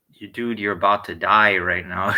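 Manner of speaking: loud, menacing retold warning; retold loud threat. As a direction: lay the threat on him loud. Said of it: "I turned around and I go".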